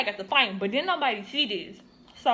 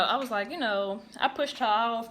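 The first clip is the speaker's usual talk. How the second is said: ordinary talk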